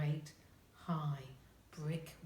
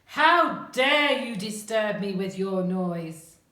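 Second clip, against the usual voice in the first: slow, booming gruff roar; roaring giant; 4 semitones higher, 14 dB louder, slower than usual. A narrator's reading. Giant scolds children for disturbing him, gruff roar. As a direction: thunder at the intruders, dragging out the words. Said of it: "he roared in a very deep, gruff voice".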